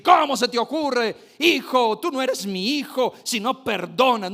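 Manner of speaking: loud, high, mock stern scolding; hammed-up reproach; scolding God. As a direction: imitate an angry father scolding his son. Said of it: "nos va a gritar".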